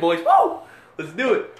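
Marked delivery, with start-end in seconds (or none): 0.2-0.6 loud high cheer; excited whoop. Real, not acted. Hyping up the podcast.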